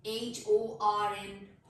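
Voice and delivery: slow letter-by-letter spelling. Shown as a demonstration. Teacher spelling a word for learners, slow spelling. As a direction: spell it out, a small gap after each letter. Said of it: "letter spell करती हूँ".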